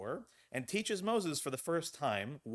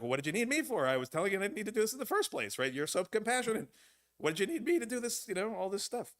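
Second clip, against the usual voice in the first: mock exasperated complaint, pitched much higher; upset Jonah; 9 semitones higher, faster than usual. Rabbi voicing Jonah complaining to God, high mock exasperation. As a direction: whine it up high like a fed-up prophet. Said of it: "He's like".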